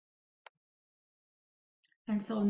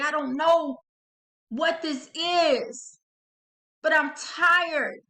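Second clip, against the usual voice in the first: fed-up; 3 semitones higher, 5 dB louder than usual. Acted. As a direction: push out each weary complaint with force. Acted fed-up outcry continues.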